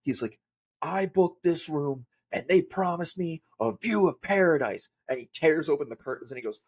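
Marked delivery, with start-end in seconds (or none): 0.8-4.9 hammed-up indignant complaint, pitched high; mock rampage. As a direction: imitate a friend's comic fury about a hotel room. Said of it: "He's on a rampage".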